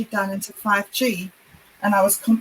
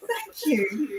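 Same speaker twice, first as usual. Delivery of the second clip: laughing; 6 semitones higher, 8 dB quieter than usual. Real, not acted. Emotional thanks after the song.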